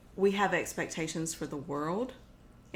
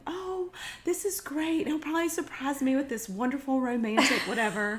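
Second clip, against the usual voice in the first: dreamy excitement; 8 semitones higher than usual. Retold or imitated. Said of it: "you're thinking".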